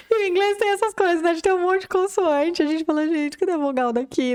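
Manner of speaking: amused disbelief in a lifted voice; laughing, high-pitched. Real, not acted. Teacher laughing to her co-host about English consonants, high and amused. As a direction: laugh through it.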